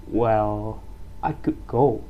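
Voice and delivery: "Well" falls, "I could go" rises unsure; hesitant, polite fall then rise. Shown as a demonstration. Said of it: "The voice falls and then rise again".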